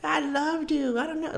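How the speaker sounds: put-on high, slow, slurring drunk ex; drunk ex impression. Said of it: "she definitely was fucking drunk".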